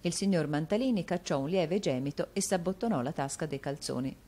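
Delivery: ordinary delivery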